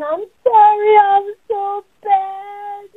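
high, loud, sing-song crying; wailing shame. Acted. Ashamed child apologizing, wailing that they're bad. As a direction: wail it like a child drowning in shame. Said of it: "They're saying".

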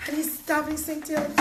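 ordinary delivery

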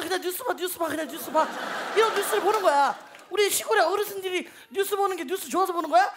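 ordinary talk

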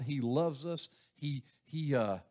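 ordinary talk, nothing marked

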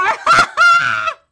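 high, loud burst of laughter. Real, not acted. Reader cracking up at the character's question, loud high laughter. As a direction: explode into a shrieking laugh.

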